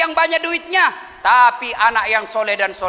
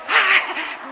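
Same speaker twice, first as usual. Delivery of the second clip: breathy laugh; 6 semitones higher than usual. Real, not acted.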